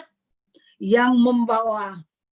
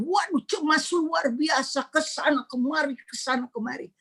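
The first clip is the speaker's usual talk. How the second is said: mock panic; 7 semitones higher, 3 dB quieter than usual. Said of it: "kepanikan begitu".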